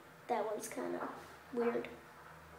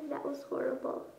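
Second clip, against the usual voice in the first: ordinary talk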